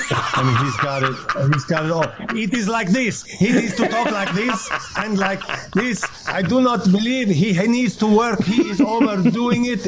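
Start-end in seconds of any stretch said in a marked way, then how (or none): 2.4-8.4 higher-pitched mock philosopher accent; Zizek's accent. Shown as a demonstration. Son showing father how the accent should be done, put-on philosopher accent. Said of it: "does not know how to do the accent". 8.4-9.9 high-pitched put-on philosopher accent; Zizek's accent. Shown as a demonstration. Son mocking an overdone imitation in the philosopher's accent. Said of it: "does not know how to do the accent".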